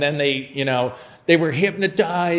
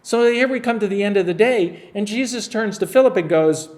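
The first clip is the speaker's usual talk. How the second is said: ordinary talk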